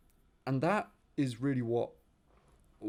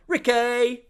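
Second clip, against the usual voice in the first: loud, high EastEnders yell; EastEnders accent; 12 semitones higher, 13 dB louder than usual. Acted. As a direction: bellow it like an East London soap character. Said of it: "it's like EastEnders".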